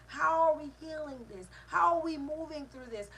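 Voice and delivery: normal talking voice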